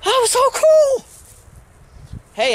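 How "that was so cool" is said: high, loud squeal of delight; thrilled squeal. Real, not acted. Railfan squealing at the passing train.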